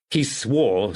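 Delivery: ordinary delivery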